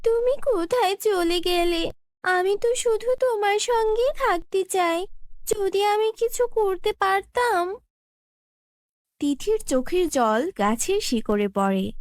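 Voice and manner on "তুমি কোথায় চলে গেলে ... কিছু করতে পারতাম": tearful lament. Acted. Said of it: "কান্না করতে শুরু করে".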